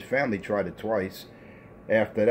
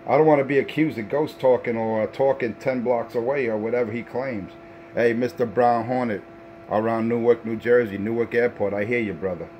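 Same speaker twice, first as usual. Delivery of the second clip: radio call-out; 2 semitones higher than usual. Acted. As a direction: hype yourself up calling over the airwaves. Shouting call-outs over the radio.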